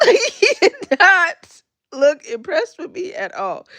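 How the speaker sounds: real laughter spilling into the words; laughing. Real, not acted. Telling viewers about her unimpressed son, laughing. Laughing at baby's grumpy look.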